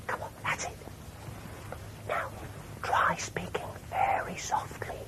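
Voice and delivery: whisper. Shown as a demonstration. Holds whisper.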